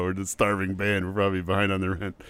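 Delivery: ordinary delivery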